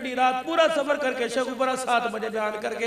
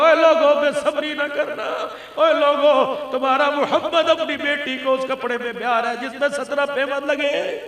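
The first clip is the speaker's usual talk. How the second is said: loud rallying cry to the congregation; fervent preacher's appeal; impassioned preaching; 4 semitones higher, 6 dB louder than usual. Real, not acted. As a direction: cry out the call to the people, then press on fervently. Preacher cries out to crowd.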